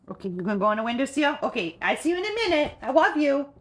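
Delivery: high sing-song talk to a dog. Real, not acted. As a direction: coo at the puppy. Cooing to dog leaving.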